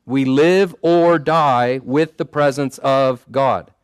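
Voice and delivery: slow, weighty, every word leaned on; emphatic repetition. Real, not acted. Preacher drilling the key line into the congregation, slow and emphatic. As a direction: hammer the line home for the congregation. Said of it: "we have to emphasize something by repeating it three times".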